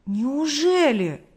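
disbelieving. A narrator's reading. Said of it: "Парень не верил своим ушам". (протяжно, не веря своим ушам)

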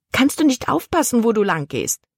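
sharp, high hissed rebuke. A narrator's reading. Soaked girl hissing at the clumsy boy. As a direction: spit the words at the careless boy. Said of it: "zischte sie".

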